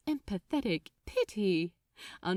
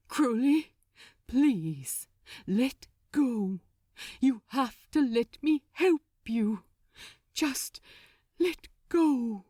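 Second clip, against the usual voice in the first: urgent plea; 3 semitones higher, 4 dB louder than usual. Acted. Angel pleading with raging demon.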